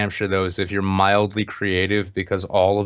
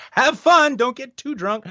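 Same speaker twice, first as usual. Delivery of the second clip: parents; 13 semitones higher, 3 dB louder, slower than usual. Retold or imitated.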